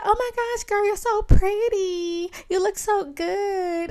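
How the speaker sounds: put-on high, slow, gushing party-girl voice; gushing drunk girl. Woman gushing to drunk girl in club bathroom, high and slow.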